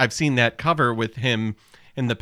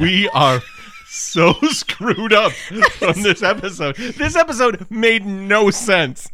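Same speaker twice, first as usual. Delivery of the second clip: laughing exasperation; 4 semitones higher, 6 dB louder, slower than usual. Real, not acted.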